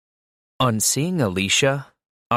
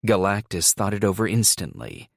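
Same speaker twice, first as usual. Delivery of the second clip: ordinary delivery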